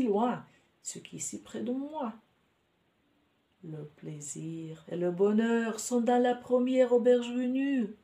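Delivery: elated musing. A narrator's reading. Man telling himself pleasure waits at the nearest inn, elated. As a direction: read the thought out with rising elation.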